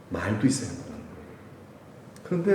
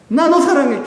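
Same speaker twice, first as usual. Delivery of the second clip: much higher, louder I love you; loving declaration; 12 semitones higher, 7 dB louder than usual. Acted. Jesus voiced telling the preacher he loves him, higher and louder. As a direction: call it out joyfully.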